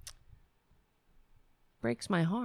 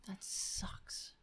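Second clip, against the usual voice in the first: whispered under her breath; 15 dB quieter than usual. Real, not acted. Muted by mistake and annoyed, a soft whisper to herself. Muttering frustration after mute mishap.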